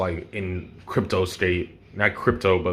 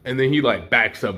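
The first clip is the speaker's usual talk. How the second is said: ordinary delivery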